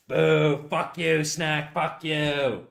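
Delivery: lightly voiced booing crowd; mock booing. Streamer playing chat booing their boring normal voice, mock booing. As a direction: jeer like a disappointed audience, playfully.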